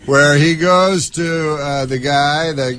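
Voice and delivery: ordinary talk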